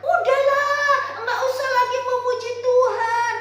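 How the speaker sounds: high, loud scolding wife; angry wife. Acted. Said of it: "Ibu ini marah-marah".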